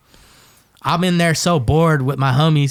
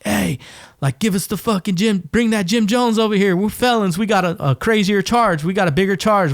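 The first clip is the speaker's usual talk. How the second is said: higher, indignant demand; rowdy inmate demand; 6 semitones higher than usual. Retold or imitated. Felons demanding the Kool-Aid first, higher indignant voice. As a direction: complain like a tough felon wanting his share. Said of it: "they're like".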